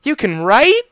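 high, loud disbelief; surprised guard. Acted. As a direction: gasp out the question.

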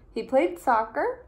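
usual speaking voice

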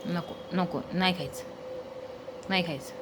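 low, soft pretend dog refusing; voicing the dog. Owner voicing dog Jimmy refusing toast, low and soft.

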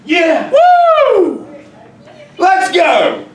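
high, loud hype-up cheer; excited cheer. Real, not acted. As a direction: pump up the class. Teacher celebrates student's answer.

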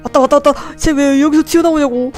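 loud panicked yelling; panicking gamer. Real, not acted. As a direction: shout as it jumps out. Monster coming in horror game.

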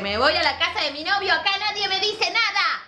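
lightly voiced defiant teenager, rising at the end; mocking teen girl. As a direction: mimic the girl's cheeky declaration.

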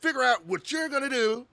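lightly mimicking the animated teacher; imitating the teacher. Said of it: "I like how animated that Mister Gerson is".

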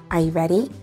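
ordinary delivery

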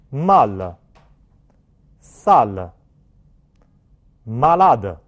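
Ga, la, ma as separate French syllables with open, tense a; French word drill. Shown as a demonstration. Teacher has class repeat French words, each a open and tense. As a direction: model each word from Ga to malade alone, firmly, with a wide open a. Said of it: "把这个元音放在单词里面".

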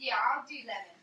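ordinary delivery

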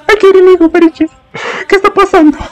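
high, fretful voice asking who to pick; high dithering mock panic; voicing torn lover. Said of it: "él está como".

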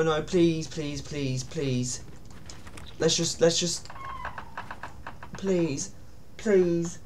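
soft, frightened begging please; begging the game to spare you; whispered scared pleading; quiet pleading. Real, not acted. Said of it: "I can't deal with this".

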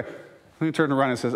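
normal talking voice

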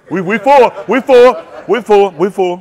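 loud proud yell; shouting we're full. Acted. Comic bragging to kids, loud shout. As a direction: belt it out like the house is packed.

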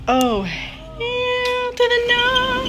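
drawn-out refusal. Real, not acted. Reacting to something behind AC.

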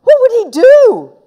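high, loud anxious question; rising panic. A narrator's reading. Boy fearing he shrank his friend, loud panicked question. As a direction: let alarm push the question up high.

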